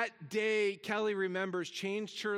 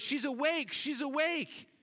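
raised mock paramedic shout, higher; excited paramedics calling out; retold shout; 7 semitones higher than usual. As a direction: call out she's awake, pitched up. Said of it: "they shouted".

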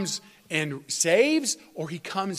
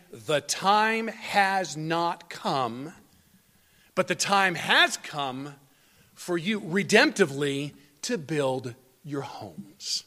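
"The time has not come" drawn out very slowly, then "has come" stressed; slow, deliberate pulpit emphasis on "has"; emphatic slow contrast; slower than usual. Acted. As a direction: spell out the contrast slowly and pointedly. Preacher contrasts their claim with God's.

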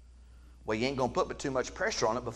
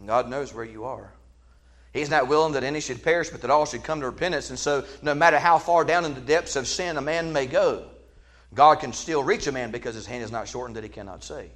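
ordinary talk

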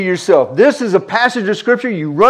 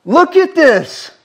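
higher, slow, marvelling look at this; awed call; 8 semitones higher, slower than usual. Retold or imitated. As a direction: point in wonder, drawn out. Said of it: "all they're doing is like".

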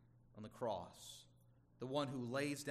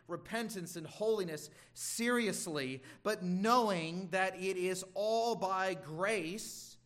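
louder, rising preacher's exhortation; preaching with force; 3 semitones higher, 4 dB louder than usual. Acted. Pastor urging the congregation, preaching with force. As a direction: press the point home to the congregation.

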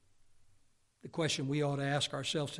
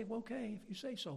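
higher, softer offhand brush-off; mock shrug; 7 semitones higher, 8 dB quieter than usual. Jesus as he did not speak, higher and softer offhand. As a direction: toss it away, unconvinced. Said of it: "Lord didn't say".

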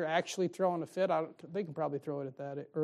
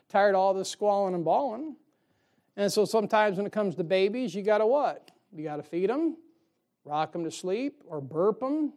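ordinary delivery

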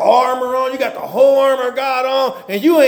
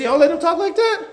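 outraged David; 7 semitones higher than usual. Retold or imitated. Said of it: "David said".

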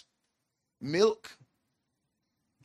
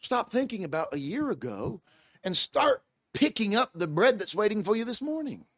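ordinary talk, nothing marked

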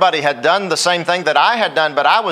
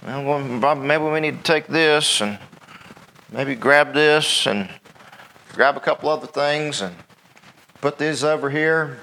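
a mild dawdling man fussing over his things; dithering Lot; 3 semitones lower, 3 dB quieter than usual. Retold or imitated. Preacher plays Lot dawdling while told to flee, mildly distracted. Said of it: "you see him just kinda piddling".

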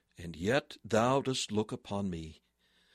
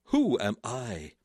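humbled cry. Retold or imitated. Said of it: "he cried".

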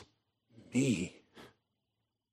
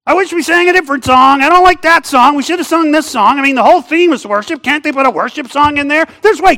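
loud, higher complaining churchgoer; grumbling churchgoer; 8 semitones higher, 5 dB louder than usual. Pretend. Pastor mocks a churchgoer griping about the song, loud grumble. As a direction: gripe about the song like a bored pew-sitter. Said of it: "let me act like I'm singing here".

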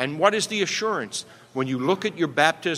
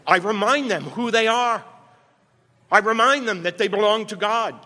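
firm parental admonition; 5 semitones higher than usual. Retold or imitated. Henry voiced as parent scolding.

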